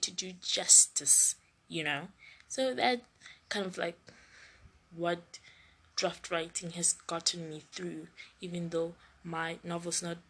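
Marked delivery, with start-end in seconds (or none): none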